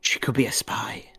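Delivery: hushed warning whisper. A narrator's reading. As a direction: whisper it close to the ear. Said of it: "whispers in my ear".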